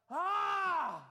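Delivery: a loud anguished cry of Ah, high and strained; terrible groan. Retold or imitated. Preacher voicing Jeremiah's despair to God, anguished loud groan. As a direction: groan it out like a man at the end of his rope. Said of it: "it means a terrible groan".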